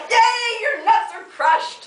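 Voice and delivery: giggling cheer to a partner whose nuts were just crushed. Retold or imitated. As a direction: cheer like a delighted little girl, laughing. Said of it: "giggling and like".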